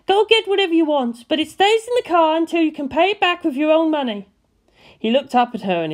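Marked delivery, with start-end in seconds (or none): 0.1-4.3 higher, grudging woman setting terms; Misato's voice. A narrator's reading. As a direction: give in but lay down the rule. Misato conceding.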